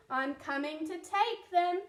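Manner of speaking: loud greedy yell. Acted. The rat shouting into the cave, loud yell. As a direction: shout it out hungrily. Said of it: "the rat thief yelled".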